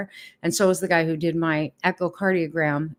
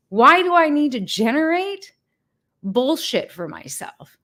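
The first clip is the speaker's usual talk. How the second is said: exasperated; 8 semitones higher than usual. Real, not acted. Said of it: "venting frustration".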